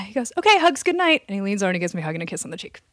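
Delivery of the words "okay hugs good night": high, loud sing-out; imitating pushy roommate. Roommate asking for a bedtime hug, imitated high and loud. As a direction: mimic an over-eager bedtime call.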